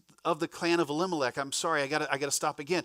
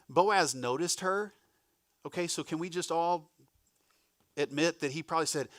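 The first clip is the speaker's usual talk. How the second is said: usual speaking voice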